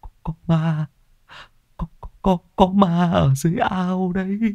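trembling high stutter about the ghost; frightened stammer. Acted. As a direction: stammer it out still shaking. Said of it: "Ông lắp bắp".

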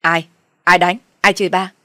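loud, indignant questioning; indignant demand. Acted. Husband demanding who hit his wife, indignant. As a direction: demand the culprit's name loudly.

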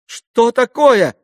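very high, loud, drawn-out question; alarmed cry. A narrator's reading. Lise hears of the hysterics, shouting in alarm. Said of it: "закричала Лиз уже тревожным голосом".

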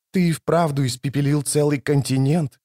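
usual speaking voice

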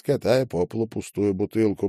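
ordinary delivery, nothing marked

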